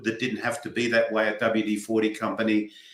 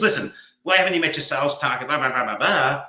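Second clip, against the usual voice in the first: rapid-fire scolding boss, higher pitch; confrontational demand; 4 semitones higher, faster than usual. Acted. Boss running into someone's office over a missed sales target, confrontational and rushed. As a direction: press for an explanation in a rushed rant. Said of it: "running into someone's office because they haven't met their sales target".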